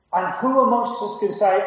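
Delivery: usual speaking voice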